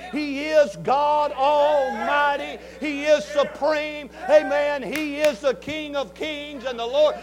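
loud, slow preacher's cadence; preaching. Acted. Preacher to congregation, full-force preaching.

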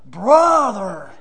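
loud, drawn-out greeting in retelling; imitating an eager caller; hearty greeting. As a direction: hail a buddy with a long, loud call. Retold excited greeting on the phone.

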